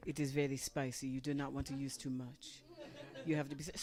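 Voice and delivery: low, grave warning voice; hint of a stern waiter; imitating waiter. Waiter warning about pepper, low and solemn. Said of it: "was like".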